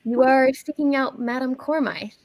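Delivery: a hint of a wise old woman; old lady voice. GM voices Madame Cormyth.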